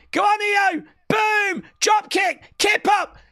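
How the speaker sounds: high, loud cheering; excited shouting. Real, not acted. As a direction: yell her through the moves. Cheering big move.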